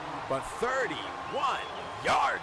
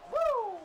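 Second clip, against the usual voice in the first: excited woo. Acted. Celebrating the touchdown.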